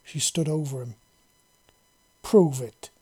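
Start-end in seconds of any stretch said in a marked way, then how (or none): 2.2-2.9 a command that allows no argument; stern alien commander demanding proof. A narrator's reading. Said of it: "she said in a commanding voice".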